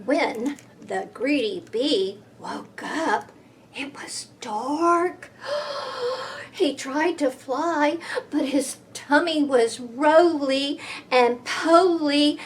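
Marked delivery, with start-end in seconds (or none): 5.4-6.5 high quiet gasp. Acted. Reader gasping as the bee wakes in the dark. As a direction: catch your breath in alarm.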